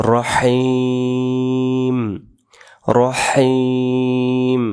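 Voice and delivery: রহিম stretched very long and sung; slow tajweed. Shown as a demonstration. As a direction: hold রহিম out like in recitation. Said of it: "এটা হবে".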